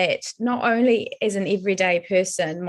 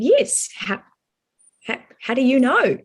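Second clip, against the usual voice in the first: surprised; 5 semitones higher than usual. Retold or imitated. Surprised reply to strangers' question.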